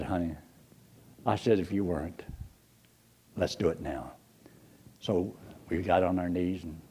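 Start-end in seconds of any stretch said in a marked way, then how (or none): 0.0-4.1 low, soft reassurance; calm her gently, low and soft; tender husband. Retold or imitated. Husband comforting crying wife, low and soft.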